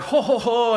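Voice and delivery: high mock ho-ho-ho laugh; mimicking the tall guy laughing at the joke; imitated hearty laugh. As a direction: ham up a big guy's chuckle. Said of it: "and the guy's like".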